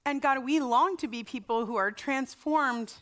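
slow, earnest prayer voice; closing prayer. Acted. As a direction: pray it gently to the congregation. Pastor closes in prayer.

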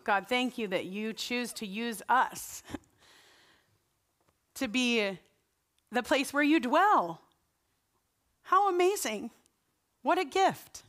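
slow, earnest prayer voice; closing prayer. Acted. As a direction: pray it gently to the congregation. Pastor closes in prayer.

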